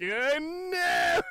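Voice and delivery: high, stretched-out mock wail; hammed-up cry of comic dismay; imitating panicked cry. Imitating Clouseau's cry in mid-air.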